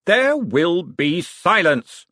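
drawn-out authoritative shout; commanding shout. A narrator's reading. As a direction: call the whole room to order. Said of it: "shouted Vetinari".

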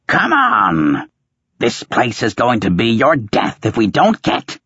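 high, insistent fishwife shrill. Acted. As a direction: nag him hard like a fishwife. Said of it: "The fishwife's shrill insisted".

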